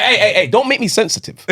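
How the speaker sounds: high-pitched playful protest; mock-defensive retort. Dad answers his child calling him touchy, mock-defensive.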